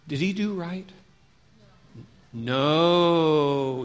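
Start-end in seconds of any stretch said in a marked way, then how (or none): 2.3-3.8 long drawn-out no; kids' chorus answer. Shown as a demonstration. Teacher models the kids' reply, long chanted no. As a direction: stretch the no out like a class answering.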